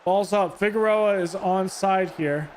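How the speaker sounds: usual speaking voice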